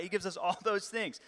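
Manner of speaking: ordinary delivery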